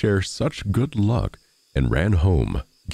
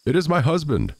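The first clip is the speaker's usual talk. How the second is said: crying out; 11 semitones higher than usual. A narrator's reading. Wife alarmed at her husband's knock, crying out. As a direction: cry it out lightly in dismay. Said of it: "cried the woman".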